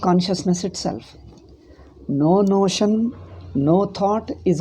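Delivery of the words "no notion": "No notion" slow, higher, leaned on; stress on NO. Shown as a demonstration. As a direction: draw out "No notion" with weight. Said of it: "emphatic teaching".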